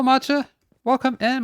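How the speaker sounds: ordinary talk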